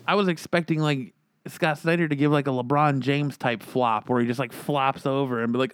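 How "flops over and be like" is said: ordinary talk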